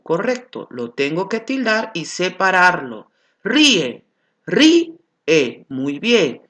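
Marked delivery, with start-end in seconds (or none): 3.4-5.7 Ríe repeated, louder, I stressed; word demo. Shown as a demonstration. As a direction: model Ríe with a strong I. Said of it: "La I suena más fuerte".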